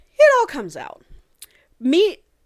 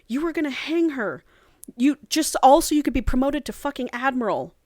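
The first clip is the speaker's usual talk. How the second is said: retold scream; 6 semitones higher than usual. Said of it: "And they're just screaming".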